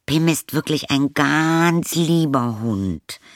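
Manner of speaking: put-on girl assures the caretaker her dog is good, slowly; sweet pleading girl. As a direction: coax the caretaker gently.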